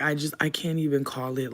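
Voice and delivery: ordinary talk, nothing marked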